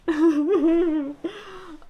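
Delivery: high teary laugh-sob breaking out; crying laugh. Real, not acted. Reader laughing and crying over a book. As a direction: laugh through real tears. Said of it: "I'm gonna cry".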